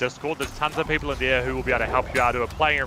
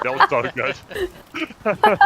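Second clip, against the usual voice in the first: delighted squeal; 5 dB louder, much slower than usual. Real, not acted. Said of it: "you should be a voice actor".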